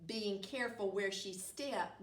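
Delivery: plain reading voice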